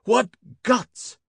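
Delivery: high, admiring shout; excited shout. A narrator's reading. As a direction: cheer the pilots' nerve. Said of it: "shouted the Stubbs".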